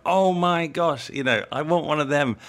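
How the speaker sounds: mock-gush like a thrilled colleague; imitating delighted coworkers raving; gushing excitement. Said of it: "when they go".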